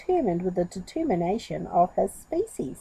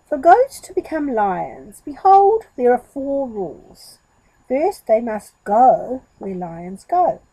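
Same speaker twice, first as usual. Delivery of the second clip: great deliberation; 3 semitones higher, 7 dB louder than usual. Acted. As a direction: lay down the rules like a solemn teacher. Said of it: "spoke with great deliberation".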